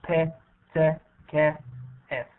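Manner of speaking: "P, T, K, F" given one at a time as voiceless consonants; voiceless sounds. Shown as a demonstration. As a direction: list "P", "T", "K", "F" as separate short sounds. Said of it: "these voiceless sounds".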